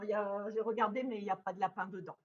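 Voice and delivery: ordinary talk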